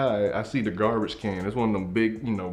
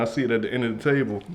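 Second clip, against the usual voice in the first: normal talking voice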